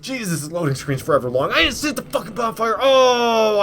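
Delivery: furious gamer yelling at the screen; real frustrated outburst. Real, not acted. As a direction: blow up at his own mistake. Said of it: "I'm so pissed at myself".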